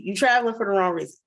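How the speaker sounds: ordinary delivery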